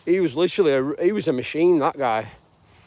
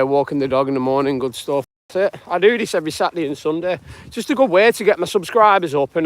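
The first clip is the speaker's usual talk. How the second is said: normal talking voice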